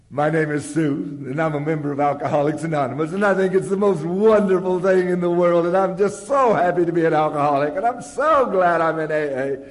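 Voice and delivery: high, loud mock-gushing testimony; gushing member. Sue sharing at the meeting, hammed-up gush. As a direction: overdo the bliss, sugary and loud. Gal trots up to microphone.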